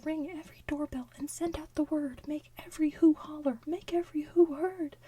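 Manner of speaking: loud rallying call. Acted. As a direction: rouse the crowd. Calling everyone to shout.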